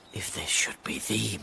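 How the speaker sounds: breathless hushed wish; hopeful whisper. Acted. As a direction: whisper it to yourself in hope. Malvolio hoping to himself.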